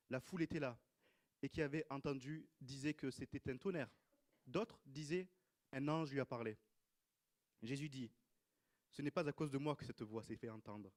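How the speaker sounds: measured public Bible reading; projected reading with pauses between phrases; scripture reading. Real, not acted. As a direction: read out the Gospel with clear emphasis, phrase by phrase. Reads Gospel passage aloud.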